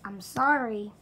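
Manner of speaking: blurted loud fake apology, sorry dropping; a youtuber belting an apology at the camera. Youtuber to subscribers, loud hammed-up sorry.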